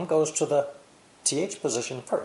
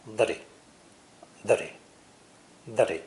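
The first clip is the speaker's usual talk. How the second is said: American flap T; faster than usual. Shown as a demonstration. Teacher demonstrating the American flap T. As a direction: tap the T lightly like a D. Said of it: "my American flap T sound".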